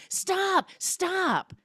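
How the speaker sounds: high-pitched interruption; mock insistent plea. Retold lover cutting off her apology.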